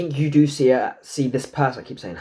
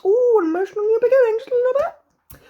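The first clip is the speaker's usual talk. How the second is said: high squeaky excitement; faster than usual. Acted. Sudden squeaky excited card reading.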